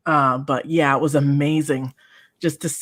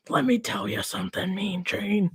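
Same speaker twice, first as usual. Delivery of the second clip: a hint of a quick, softer wrestler promo voice; 3 semitones higher, 7 dB quieter than usual. Child playing with wrestling toys, mild wrestler voice. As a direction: lightly voice a wrestler talking to Mean Gene.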